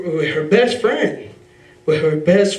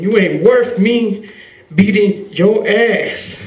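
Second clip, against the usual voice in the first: louder, slower, higher, each word pressed out; loud, slow, contemptuous defiance; defiant threat; 4 semitones higher, 5 dB louder, much slower than usual. Retold or imitated. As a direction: spit it slowly at the man who beat you. Boy defying abusive stepdad.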